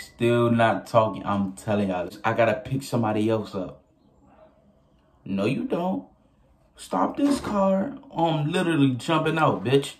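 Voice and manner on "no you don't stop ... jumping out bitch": higher, slow "Stop this car" as a mock command; demanding he stop. Passenger ordering the driver to stop, mock angry. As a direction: bark the order to stop the car, half acted. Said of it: "That's what I told him".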